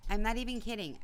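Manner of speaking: ordinary talk, nothing marked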